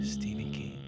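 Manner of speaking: deep, hushed, ominous; spooky horror voice. Pretend. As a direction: whisper the name like a curse. Spooky name echo.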